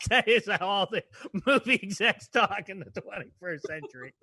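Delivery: tickled, chuckling between phrases; laughing. Real, not acted. Co-host marvelling at how movie execs talk, laughing. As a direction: talk through real laughter.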